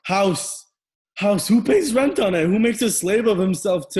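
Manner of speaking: high, loud, indignant challenge; furious retort. Acted. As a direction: throw her word back angrily. Son retorts in quarrel.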